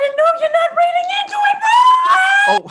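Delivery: high, loud indignant yell; angry shout. Acted. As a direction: explode at him, offended. Angry outburst about voice.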